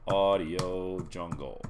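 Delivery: slow, savoring each word; drawn out slowly. Shown as a demonstration. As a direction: stretch the name out slowly. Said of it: "You can actually see it".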